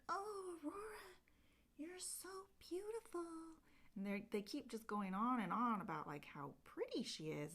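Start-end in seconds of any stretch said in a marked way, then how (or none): 0.1-3.2 fairy voice. Retold or imitated. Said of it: "they're like".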